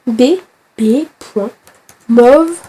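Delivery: slow spelled-out dictation. Shown as a demonstration. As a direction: spell out B B point slowly, each letter apart, in a French accent. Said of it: "je parle avec l'accent français". (dicté lentement, B B point lettre par lettre, accent français)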